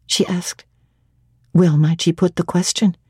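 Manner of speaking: ordinary delivery, nothing marked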